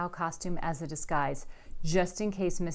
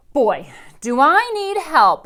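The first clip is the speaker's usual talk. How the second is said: slow, high, loud plea; desperate; 9 semitones higher, 15 dB louder, slower than usual. A narrator's reading. Rascal pleads to fairy godmother, slow loud plea. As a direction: beg for help dramatically.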